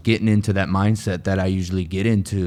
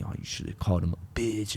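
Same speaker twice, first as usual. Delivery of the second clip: much softer, sly tempting inner voice; voice of his ego; 2 semitones higher, 10 dB quieter than usual. Retold or imitated. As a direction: voice the ego goading him on quietly. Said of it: "my ego got involved. It was like".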